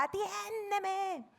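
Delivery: desperate plea. Shown as a demonstration. Preacher shows David's desperate cry to God, "atiéndeme" pleaded high and slow. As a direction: stretch "atiéndeme" high and slow like a begging prayer.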